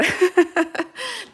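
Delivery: short high laugh, rising; high giggle. Real, not acted. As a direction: giggle it off lightly. Laughs as viewers drop.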